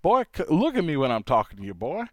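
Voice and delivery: loud, high Southern scolding; hammed-up Southern accent, commanding. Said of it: "It sounds much more likely to say".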